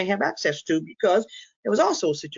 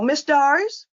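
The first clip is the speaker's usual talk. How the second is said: high, raised call of a name, lifted at the end; getting someone's attention; 10 semitones higher, 4 dB louder, slower than usual. Shown as a demonstration. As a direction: call the name, then hold for a response. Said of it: "wait until the person acknowledges you".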